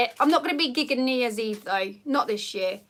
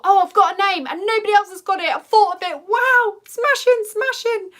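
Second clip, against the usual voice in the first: higher, louder, gushing mock joy; mock delight; 7 semitones higher, 6 dB louder than usual. Mum-to-be mocking her own glee at a name, hammed-up delight. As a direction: crow over the name like you found treasure.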